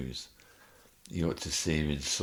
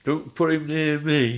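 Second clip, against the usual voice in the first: mock scream; 10 semitones higher, 7 dB louder than usual. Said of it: "screaming out the ward".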